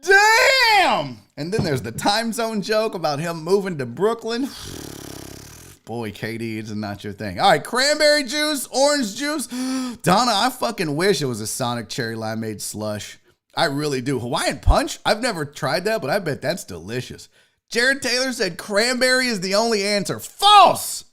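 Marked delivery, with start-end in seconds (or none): 0.0-1.2 high, loud, long crying-out Damn; Damn stretched into an anguished shout; even louder damn. Real, not acted. Repeats reaction, longer. 20.2-21.0 high, loud False; False shouted to overrule a viewer; loud rejection. Real, not acted. Rejecting a viewer's drink pick.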